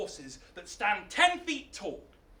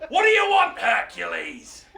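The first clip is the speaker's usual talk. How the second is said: bellowed question; 7 dB louder than usual. Acted. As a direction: bellow it at the visitor as a mad king. Mad king turns on Hercules.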